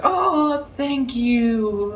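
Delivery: high, drawn-out mock thanks; teenage sister. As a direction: imitate a sister's high-pitched thank-you. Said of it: "I gave the bracelet to Marissa".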